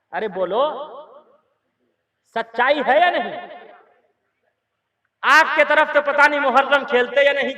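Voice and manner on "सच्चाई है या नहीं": usual speaking voice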